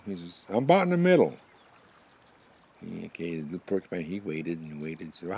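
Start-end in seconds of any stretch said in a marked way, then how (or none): 0.5-1.4 loud, high-pitched answer; calling back. Acted. Buffalo answers the porcupine in his stomach, calling back. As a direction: holler the progress report, voice up high.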